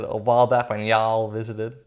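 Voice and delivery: slow, drawn-out cowboy accent on "y'all". Shown as a demonstration. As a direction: stretch it out like a Texas cowboy. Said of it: "It's put on the cowboy accent".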